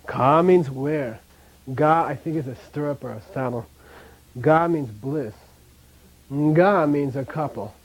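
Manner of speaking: slow, each initial "Ka", "Ga", "Nga" set apart clearly; contrasting initials. Shown as a demonstration. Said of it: "You see what I mean?".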